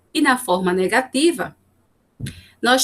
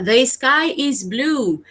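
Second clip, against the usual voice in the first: slow example read; much slower than usual. Shown as a demonstration. Teacher reads English example.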